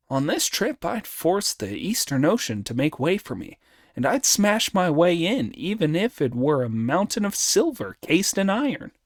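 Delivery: high, swaggering monkey hero; Monkey, boastful. Acted. Monkey answering Friar Sand's warning, boastful. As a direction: brag in a high, animated voice.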